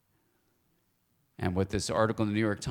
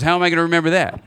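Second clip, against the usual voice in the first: loud, fast, much higher complaint; exasperated student; 8 semitones higher, 12 dB louder, much faster than usual. Retold or imitated. Student loudly protesting to her teacher, fast and exasperated. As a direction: blurt it out like a frustrated student. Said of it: "She goes".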